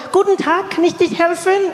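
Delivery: high, friendly German greeting; friendly woman. Acted. Counter woman offering help, friendly. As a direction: greet a customer pleasantly in German, pitched high. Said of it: "The woman was really friendly".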